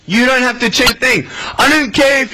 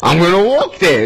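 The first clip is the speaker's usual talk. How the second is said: ordinary talk, nothing marked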